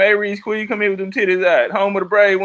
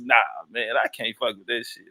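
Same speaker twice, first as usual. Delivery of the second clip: deep mock voice; 10 semitones lower, 7 dB quieter than usual. Retelling his own refusal.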